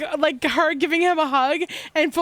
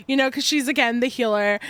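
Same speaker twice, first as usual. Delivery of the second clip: usual speaking voice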